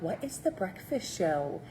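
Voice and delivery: lightly voiced confused newcomer asking; a mild, puzzled what-is-this question; puzzled new viewer. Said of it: "you're like".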